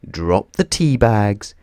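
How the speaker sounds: ordinary delivery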